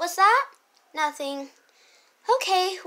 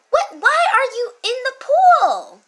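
higher and louder, demanding an answer; shocked sister; 8 semitones higher, 7 dB louder than usual. Acted. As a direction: demand it, baffled. Sister questions sibling in pool.

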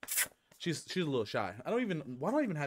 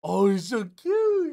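gushing over dog; 7 semitones higher, 11 dB louder, much slower than usual. Real, not acted. Cooing at the dog on camera.

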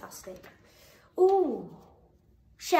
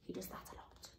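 whispered aside; 4 semitones lower, 17 dB quieter than usual. Real, not acted. Joking aside about partner.

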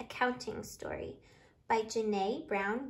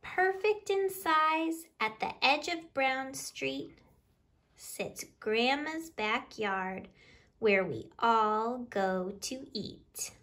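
louder, lively picture-book reading in rhyme; reading to children; 6 dB louder than usual. Acted. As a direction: read the counting rhyme out to the little ones with bounce. Reads picture book aloud.

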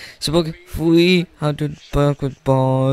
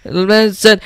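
plain reading voice

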